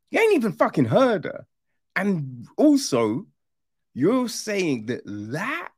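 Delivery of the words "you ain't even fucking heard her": exasperated retort. Retold or imitated. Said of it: "And I'm like".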